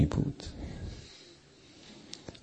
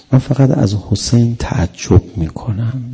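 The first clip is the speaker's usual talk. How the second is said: normal talking voice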